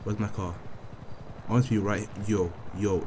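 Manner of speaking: ordinary delivery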